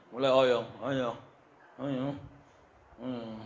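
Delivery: fading, drowsy and soft; sleepy trailing mumble; dozing off. Retold or imitated. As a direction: let the words nod off into sleep. Mimics dozing off in prayer.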